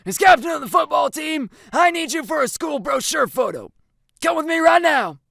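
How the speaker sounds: commanding shout; barking coach. A narrator's reading. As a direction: bark orders across the classroom. Coach orders student out.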